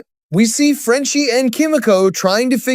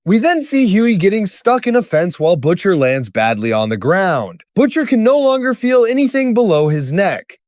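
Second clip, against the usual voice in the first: usual speaking voice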